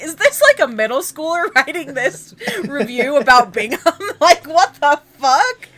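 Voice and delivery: high, giggling disbelief; laughing. Real, not acted. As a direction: laugh through the question. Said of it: "They sound like somebody".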